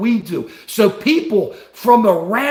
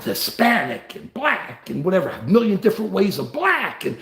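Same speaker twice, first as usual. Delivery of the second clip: normal talking voice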